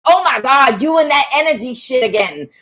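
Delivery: imitated exasperated outburst; angry, cussing friend. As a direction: snap like a fed-up friend. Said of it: "she's just getting all angry and cussing".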